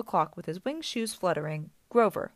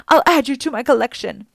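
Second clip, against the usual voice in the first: loud, higher, furious threat; enraged snarl; 5 semitones higher, 11 dB louder than usual. A narrator's reading. Medusa threatens the satyr who hit her, snarling loudly. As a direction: threaten at full voice. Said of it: "she snarled".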